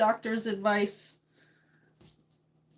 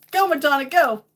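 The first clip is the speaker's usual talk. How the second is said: loud, higher cheer; 4 semitones higher, 7 dB louder than usual. Real, not acted. Streamer rooting for [name], loud excited cheer.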